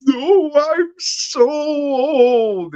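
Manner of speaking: very high and very slow, drawn-out granny moan; mock old lady voice. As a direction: ham up a feeble old woman. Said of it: "doing her old lady voice".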